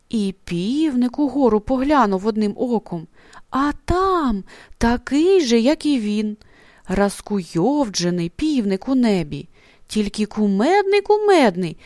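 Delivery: plain reading voice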